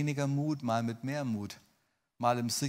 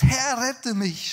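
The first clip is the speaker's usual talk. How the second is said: high, loud drawn-out shout for help; acted plea, yelled high; desperate cry; 13 semitones higher, 10 dB louder, much slower than usual. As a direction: cry out as if sinking. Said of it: "schrie er".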